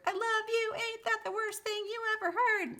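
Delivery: high, loud mock scream. Reader acting out a desperate love lyric, high screaming. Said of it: "And I scream for whatever it's worth".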